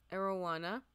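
usual speaking voice

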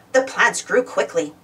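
plain reading voice

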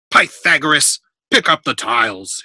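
loud, firm parental order; stern. A narrator's reading. Father ordering his son to tidy up, stern. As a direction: give the order sternly, leaning on the name. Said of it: "his father said sternly".

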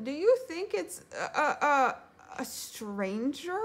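high hesitant question, slowing at the end; nervous stammer. A narrator's reading. Piglet fearing a stranger is near, nervous stammer. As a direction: falter before the dreaded word.

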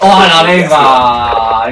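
very slow, loud, fed-up complaint; drawn-out exasperated cry. Real, not acted. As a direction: drag it out, utterly fed up. Frustrated after being humiliated again.

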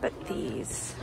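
hushed commentary. Real, not acted. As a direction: keep it down while browsing and cooing. Filming herself quietly while browsing store.

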